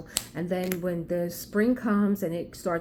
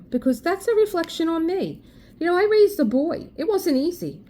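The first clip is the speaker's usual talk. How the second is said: proud; 9 semitones higher, 4 dB louder than usual. Real, not acted. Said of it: "proud of raising her son".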